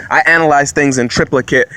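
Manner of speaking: ordinary talk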